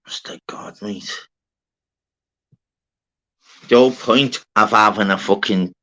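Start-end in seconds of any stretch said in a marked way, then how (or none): 0.1-1.2 breathy whisper under his breath; whispered aside. Real, not acted. Left alone on stream after the row, whispering to himself. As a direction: mutter it to yourself, barely voiced.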